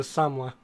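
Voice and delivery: "Samoa" as SAHM-wah; mispronounced Samoa. Retold or imitated. Quoting The Rock in a film, "Samoa" as SAHM-wah. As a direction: quote "Samoa" in two syllables, SAHM-wah. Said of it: "maybe he just can't pronounce it".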